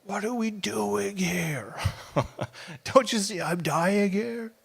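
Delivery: slow, high, pleading cry; anguished plea. Acted. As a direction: plead like you're starving. Desperate cry to God.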